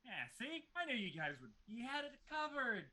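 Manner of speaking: high, fawning voice; butter them up in a high voice; Moorcroft's voice. Acted. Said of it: "Moorcroft is down there like".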